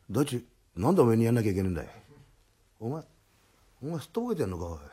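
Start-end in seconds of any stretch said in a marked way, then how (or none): none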